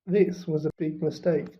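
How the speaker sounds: usual speaking voice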